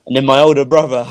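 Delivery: ordinary delivery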